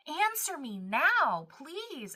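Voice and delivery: Job's pleading cry to God, acted out; mock desperate plea, pitch swooping upward. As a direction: open loud, stretch the plea and lift it high. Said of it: "where Job cries out and says".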